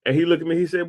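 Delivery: ordinary talk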